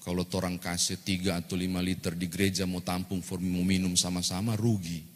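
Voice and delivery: lightly voiced Manado dialect; Manado accent. Wife grumbling to her husband about church wine, Manado accent. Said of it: "kalau saya pakai logat Manado".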